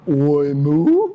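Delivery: loud oink sliding into a moo; pig's failed oink. A narrator's reading. Storyteller as pig that tried to go oink, botched loud attempt. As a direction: try to oink, end up mooing. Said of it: "tried to go oink".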